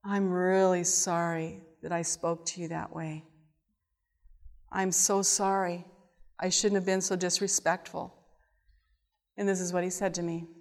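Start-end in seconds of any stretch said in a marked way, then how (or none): 0.0-8.1 softly spoken, earnest apology; retold soft apology. Speaker retelling her apology to grandpa, soft and contrite. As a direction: apologize quietly, in a subdued voice.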